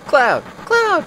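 high, loud shout of Cloud; rising frantic call; shouting a name. Acted. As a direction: yell for him across the room. Calling out to Cloud.

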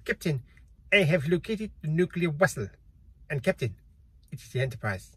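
a deliberately bad, understated Russian accent; a quiet, half-hearted Russian accent quoting the film; terrible Russian accent. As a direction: ham up a weak Russian accent for Chekov. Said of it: "That's a terrible Russian accent".

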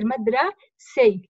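مدرسي broken before a drawn-out "sii"; مدرسي split slowly to show the kasra on س; kasra on the sīn. Shown as a demonstration. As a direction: say مدرسي slowly as "madra... sii", showing the i after s. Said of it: "لاحظوا هون كسرة هيها على السين".

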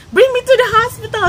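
high, loud cry; desperate plea. Retold or imitated. To the crowd of men, high loud pleading. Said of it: "Sabi kong ganyan".

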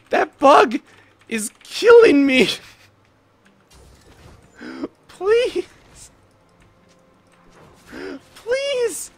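high, strained wail; anguished complaint. Real, not acted. Player begging as a bug kills him, anguished complaint. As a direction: plead in loud despair.

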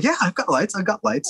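high-pitched, hasty yes; over-eager assurance. Retold or imitated. Novice director assuring Laura he has equipment, over-eager. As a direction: blurt it, desperate to seem prepared.

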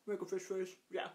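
usual speaking voice

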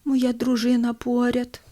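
slow, drawn-out feeble question; weak dying old man. Acted. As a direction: ask feebly from your deathbed, slowly. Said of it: "слабким голосом запитує".

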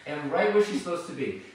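bright praise. Real, not acted. Trainer praises Maggie for finding position. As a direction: praise her warmly.

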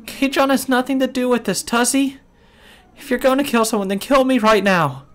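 sustained very high pleading; frantic high-pitched girl's voice; desperate wail. Acted. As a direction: beg for a friend's life in a high wail. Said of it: "rattled the iron bars as she wailed".

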